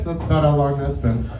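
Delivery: ordinary talk, nothing marked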